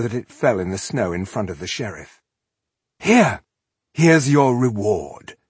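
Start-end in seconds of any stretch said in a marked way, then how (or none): 3.0-5.4 louder, with a slow finish; tossed-off payoff. A narrator's reading. Elf throwing gold to the sheriff, commanding.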